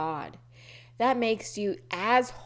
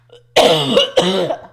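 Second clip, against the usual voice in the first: loud hacking cough; real coughing fit; 15 dB louder than usual. Real, not acted. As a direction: cough hard, choking on it. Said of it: "choking on my own".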